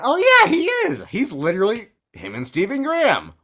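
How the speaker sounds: oh yeah shot up very high and loud, then settling; mock-squeal the sudden dawning, then come down; squeaky shocked realisation. Retelling remembering Statham is the lead, squealed surprise. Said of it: "And then I went".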